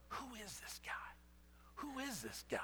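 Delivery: half-whispered amazement at the calmed storm; awed hush. Acted. Disciples staring at each other after the storm, hushed awe.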